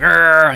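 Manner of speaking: loud straining "arr" growl; mock growl. Sonic and Metal clashing foreheads, mock battle growl. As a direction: imitate two fighters locked head-to-head. Imitates two fighters straining head-to-head.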